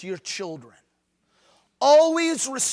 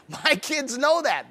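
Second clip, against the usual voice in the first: normal talking voice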